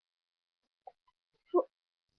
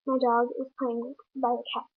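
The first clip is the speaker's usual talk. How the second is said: plain reading voice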